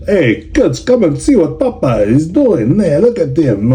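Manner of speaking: put-on botched Russian accent that slips toward Italian; bad Russian accent. Hockey dad calling his kids over, in a bad Russian accent. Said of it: "obviously can't do a Russian accent".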